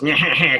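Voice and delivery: evil cartoon snicker; Gargamel. Buddy about to pull one on you, evil snicker. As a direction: snicker like a villain hatching a prank. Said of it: "that snickering, that evil, like, Gargamel from the Smurfs".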